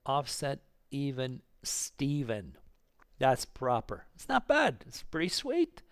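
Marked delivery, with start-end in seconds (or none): 3.2-5.8 really excited, pitch leaping up, louder. Real, not acted. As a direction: let the excitement burst out, loud and high. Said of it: "Again, excited here".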